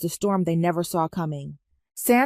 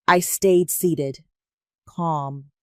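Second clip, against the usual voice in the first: ordinary delivery, nothing marked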